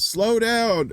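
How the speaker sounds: acted yell of advice; mock yell. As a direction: call it out as a correction. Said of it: "he yells to him".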